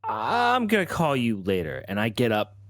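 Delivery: ordinary talk, nothing marked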